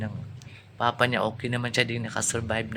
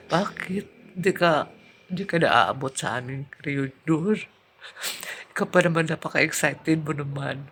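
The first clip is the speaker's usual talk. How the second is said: grieving farewell; 2 semitones higher than usual. Retold or imitated. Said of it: "Sabi ko talaga sa kanya".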